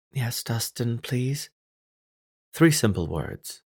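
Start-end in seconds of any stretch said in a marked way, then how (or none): 0.1-1.5 near sob. A narrator's reading. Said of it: "each released as something close to a sob".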